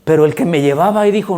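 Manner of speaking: ordinary talk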